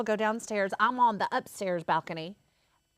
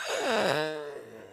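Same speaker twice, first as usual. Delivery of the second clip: burst of laughter; 7 semitones lower than usual. Real, not acted. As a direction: break up laughing. Bursting out at the mishap.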